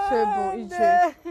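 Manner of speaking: sanglots dans la voix, lent et fort; elle parle en pleurant; pleurs. Real, not acted. Mère en pleurs consolée. (voix brisée par les larmes)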